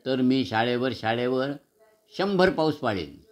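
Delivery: usual speaking voice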